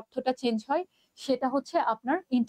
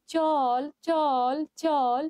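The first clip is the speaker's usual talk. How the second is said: each "চল" held long, with gaps between; languid, stretched "চল" that loses the rousing feel; very slow; 2 semitones higher, much slower than usual. Shown as a demonstration. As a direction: drag "চল, চল, চল" out very slowly. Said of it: "এটাকে যদি আমি খুব slowly বলি".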